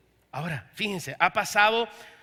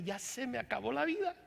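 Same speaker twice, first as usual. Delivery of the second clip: softer, laughing it off; rueful laugh; 4 semitones higher, 9 dB quieter than usual. Acted. Young man jokes his life is over, laughing softly. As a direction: chuckle through mock despair. Dreams lost, laughing at himself.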